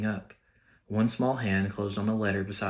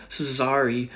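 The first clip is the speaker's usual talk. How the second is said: higher, louder sobbing apology; sobbed; 5 semitones higher, 5 dB louder than usual. Acted. Hagrid apologising to McGonagall, sobbing loudly. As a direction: choke the word out through tears. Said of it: "sobbed Hagrid".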